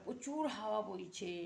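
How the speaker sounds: ordinary delivery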